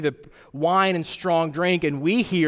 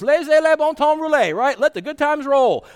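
mock party cheer; 8 semitones higher, 5 dB louder than usual. Preacher mocking prosperity crowd.

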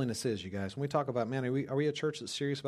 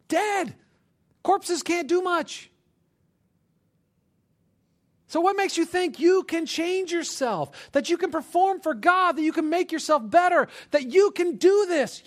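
very high, shouted sermon challenge; full-force preaching; 11 dB louder than usual. Real, not acted. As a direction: hammer it home slowly and loudly.

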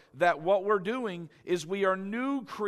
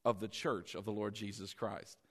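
warm welcome; 6 semitones lower than usual. Shown as a demonstration. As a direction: greet them gently into the family. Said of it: "We say".

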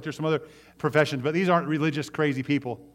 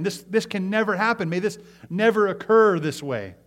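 indignant outcry; 7 semitones higher, 5 dB louder than usual. Retold or imitated. Pharisees' hearts refuse it.

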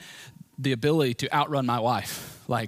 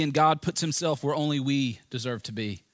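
ordinary talk

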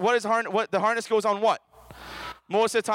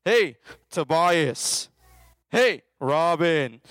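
drawn-out playful calling; calling out names; 3 semitones lower, much slower than usual. Retold or imitated. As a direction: call each kid like God trying to reach them. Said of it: "God's saying".

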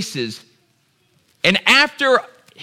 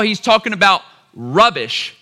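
ordinary talk

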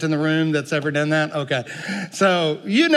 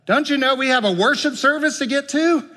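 high, mock-indignant question; acted impatience, voice pitched up; put-out churchgoer; 7 semitones higher than usual. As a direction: protest like a busy churchgoer, pitch lifted. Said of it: "I know a lot of churches like that".

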